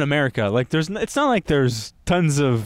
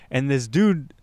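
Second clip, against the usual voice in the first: ordinary talk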